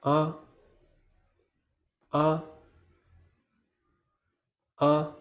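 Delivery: short, light "Ah" without stress; touch each "Ah" briefly and evenly; neutral tone. Shown as a demonstration. Teacher models the neutral tone for learners, "Ah" light and quick. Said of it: "It is pronounced softly".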